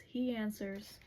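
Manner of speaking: ordinary talk, nothing marked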